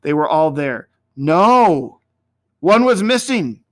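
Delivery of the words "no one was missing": sudden alarmed no; startled exclamation; alarmed cry. A narrator's reading. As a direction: gasp it out in alarm.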